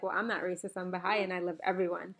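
ordinary delivery

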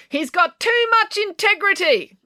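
high, very slow haughty April voice; yelling April. A narrator's reading. April calls from the porch to Loretta, haughty yell. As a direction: proclaim it from the porch.

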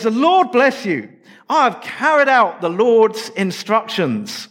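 high, slow, beaming Saul boasting; cheerful self-satisfied greeting. Acted. Saul proudly tells Samuel the job is done, high and beaming. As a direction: announce your success with a big smile in the voice. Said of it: "It's a cheerful greeting".

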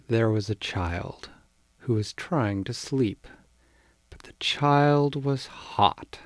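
usual speaking voice

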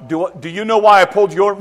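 loud, high cop questioning a driver; officer demanding an answer at the car window; mock stern officer. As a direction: play the officer leaning in. Officer voiced in traffic stop.

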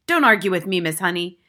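loud, stern headmistress; thundering. A narrator's reading. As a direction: thunder a reprimand. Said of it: "the headmistress thundered".

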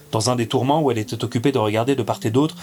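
ordinary delivery

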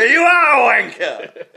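high, hammed-up shout; lone heckler's shout. Co-host retelling friend's solo heckle, mock shout. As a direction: shout it high over a hushed crowd. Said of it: "for you to stand up and go".

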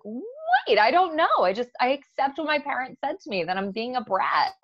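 put-on high, loud startled teen voice; surprised teen, pitch jumping up then settling; confused teen. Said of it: "a young teen who knows".

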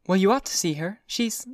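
put-on high society girl urging; Daisy insisting. A narrator's reading. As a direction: press it lightly, voice high.

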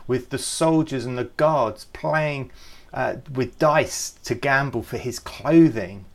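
usual speaking voice

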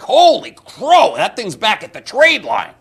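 high, loud, slowed gasp; shocked at the screen; surprised. Retold or imitated. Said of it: "it's like".

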